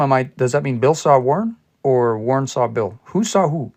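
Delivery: ordinary talk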